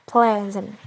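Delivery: "pleasant" with a strong first syllable; stress on PLE. Shown as a demonstration. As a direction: hit PLE in "pleasant", let the ending drop. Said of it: "the stress is on ple".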